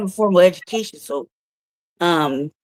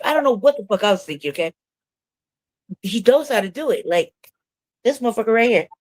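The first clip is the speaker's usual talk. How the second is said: ordinary talk, nothing marked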